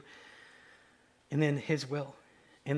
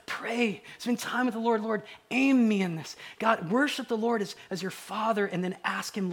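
raised, high, driving sermon voice; impassioned preaching; 6 semitones higher, 7 dB louder than usual. Acted. Preacher urging the congregation to pray first, impassioned. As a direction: press the congregation with loud urgency.

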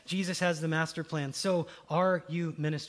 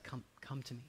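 much softer, tender come to me; soft invitation; 6 semitones lower, 13 dB quieter than usual. Acted. Jesus softly answers the stammering believer. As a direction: gently call them in.

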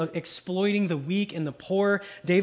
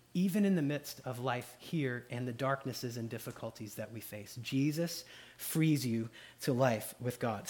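hushed and lower, drawing listeners in; soft meditative preaching; 4 semitones lower, 6 dB quieter than usual. Real, not acted. Preacher calming his listeners, hushed meditative tone. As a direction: lean in and speak it softly.

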